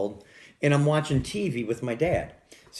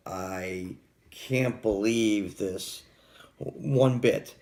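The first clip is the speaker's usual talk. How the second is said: very slow, drawn-out disbelief; retold kid's disbelief; 3 semitones lower, much slower than usual. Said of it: "as a kid, I just says".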